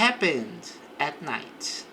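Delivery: "Happened" as two syllables, slow; slow "Happened at night", no e; silent e in happened. Shown as a demonstration. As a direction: say "Happened" with the e dropped, slowly. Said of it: "não pronuncia o E aqui".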